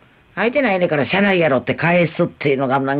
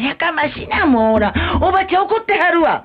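put-on high-pitched fussing at a child; imitated scolding mother; 11 semitones higher than usual. Mother hushing noisy child, mock scolding. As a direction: put on a mother's showy reprimand. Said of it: "って怒ってないのに".

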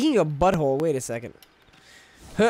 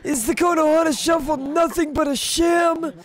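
dramatic outrage; 9 semitones higher, 3 dB louder than usual. Real, not acted. Fails minigame, dramatic outrage.

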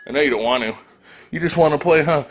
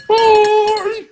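high bye; 5 dB louder than usual. Pretend. Farewell in dog voice.

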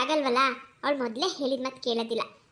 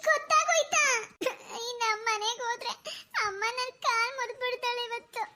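frightened; 8 semitones higher than usual. Acted. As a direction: squeal in shock, then dread going home slowly. Girl dreads mother's punishment.

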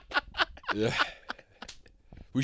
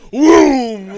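loud, high-pitched whoosh sound; imitated whoom; 11 semitones higher, 14 dB louder than usual. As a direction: cry out a big "whoom" noise. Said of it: "You're going".

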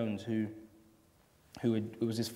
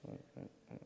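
distant off-mic; 22 dB quieter, faster than usual. Real, not acted. Mic drops out.

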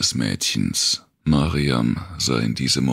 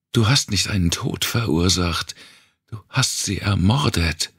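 higher, hard accusation; accusing; 6 semitones higher than usual. Acted. Mariam corrects Wanda: it was murder, accusing. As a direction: correct her coldly, pressing hard.

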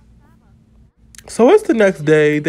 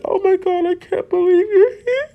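much higher, crying with happiness; tearful joy. Retold or imitated. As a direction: choke it out through happy tears. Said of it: "She's crying".

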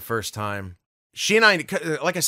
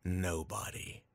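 very soft, low deadpan answer; hushed one-word punchline; quiet dismissal; 8 semitones lower, 17 dB quieter, slower than usual. Real, not acted. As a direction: drop to almost nothing, dismissively. Dismissive answer about the man.

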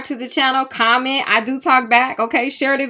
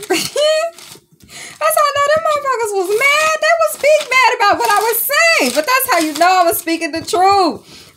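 high-pitched, laughing disbelief, pushed up louder; gleefully outraged, almost squealing; giddy high rant; 9 semitones higher, 3 dB louder than usual. Real, not acted. Telling viewers spirits burned her notebook, squeaky excited disbelief.

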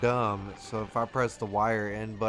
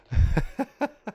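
high squealing laugh; 7 dB louder than usual. Real, not acted. Laughs after story.

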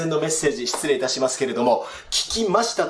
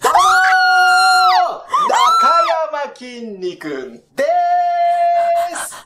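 stretched comedy greeting; 13 semitones higher, 7 dB louder, slower than usual. Acted.

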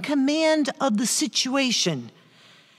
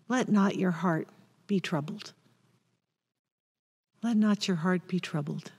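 soft comfort; 4 semitones lower, 7 dB quieter than usual. Acted. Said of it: "And Jesus says".

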